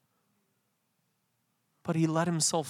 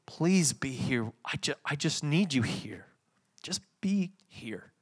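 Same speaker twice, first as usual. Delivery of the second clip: gentle plea; 5 dB quieter than usual. Retold or imitated. Jesus asking friends to stay near, gentle plea. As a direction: ask quietly, with space between phrases. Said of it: "What did he say?".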